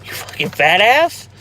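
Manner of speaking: teasing insult. Real, not acted. Teasing friend for eating.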